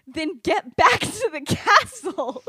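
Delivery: high, loud, slow shout breaking into laughter; hammed-up order, stretched out and laughing; mock exasperated urging. Urging friends back to the castle, mock-exasperated shout.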